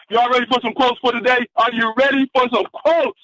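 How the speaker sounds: usual speaking voice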